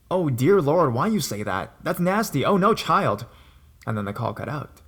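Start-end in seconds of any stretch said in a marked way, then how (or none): 0.1-3.3 higher, louder shocked American Southern-style exclamation; an appalled polite American gentleman, voice jumping up; American man's accent. Retold or imitated. Xbox seller shocked by the caller, imitated in an American accent, appalled. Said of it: "he had really awesome manners and accent".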